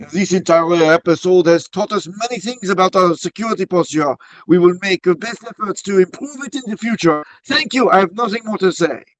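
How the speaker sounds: high-pitched, hammed-up French accent; bad Parisian accent. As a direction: do your worst Parisian accent. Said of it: "let me say it in, in my worst Parisian accent possible".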